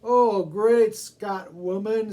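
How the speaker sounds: loud, slow exasperated call; fed-up passenger calling out. Acted. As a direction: bellow across the way. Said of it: "sang out the man".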